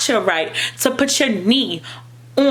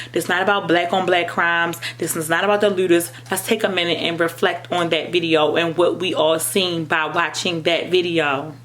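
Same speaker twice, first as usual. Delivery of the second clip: ordinary talk, nothing marked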